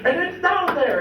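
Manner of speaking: high, loud retold yell; yelling at grandpa to fetch the deer. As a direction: shout it at grandpa in disbelief. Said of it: "And I start yelling at him".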